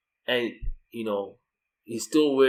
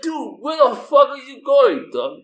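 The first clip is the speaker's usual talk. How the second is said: much higher and louder exasperated shout; furious yell after son; 13 semitones higher, 10 dB louder than usual. Retold or imitated. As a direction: bellow after the wandering kid. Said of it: "And I'm like".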